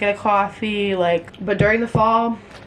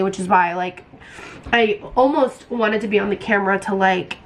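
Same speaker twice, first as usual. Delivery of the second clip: usual speaking voice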